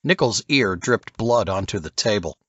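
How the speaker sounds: ordinary delivery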